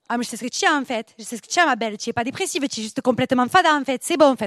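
lightly voiced southern cagole psychologist; psychagole. Cagole psychologist reassuring her patient, lightly hammed-up. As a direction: play a brash cagole as therapist, lightly. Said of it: "Une psychagole, ça n'irait pas du tout".

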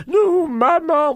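high, drawn-out cry for mum; bébé affolé. Acted. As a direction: wail it like a horrified little calf. Bébé taureau voit sa mère attaquée. (cri aigu de bébé affolé)

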